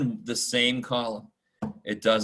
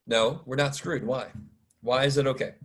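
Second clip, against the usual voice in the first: usual speaking voice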